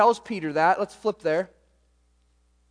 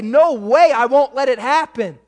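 louder, higher, Peter flatly refusing; forceful protest; 5 semitones higher, 9 dB louder than usual. Retold or imitated. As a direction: push back hard like a loyal friend. Peter protests Jesus' death plan.

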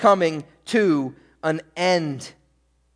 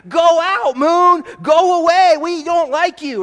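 put-on high, loud childish shouting; hammed-up kid shouts at the moon; kids yelling at the moon; 10 semitones higher, 8 dB louder, slower than usual. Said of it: "we'd be screaming and yelling at the moon".

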